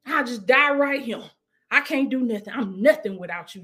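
ordinary talk

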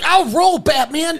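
high, loud mock bravado; mock tough guy. One friend mimicking Batman's tough-acting opponent, high mock bravado.

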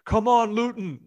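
cheering football fan. Retold or imitated. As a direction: cheer like a stranger hailing a fellow fan. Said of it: "was like".

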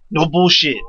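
normal talking voice

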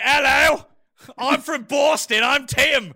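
loud, high mock Boston accent. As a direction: ham up a Boston accent, mocking a friend. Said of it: "No, you're like".

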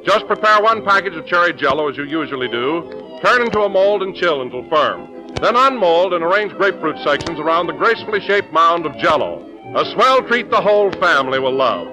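bright announcer ad read; chipper 1950s ad. Acted. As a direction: sell the dessert with cheer. Jell-O commercial read.

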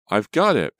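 high, loud exclamation; acted urgent yell; triumphant cry. A narrator's reading. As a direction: cry out mid-struggle. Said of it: "Pam cried".

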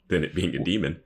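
usual speaking voice

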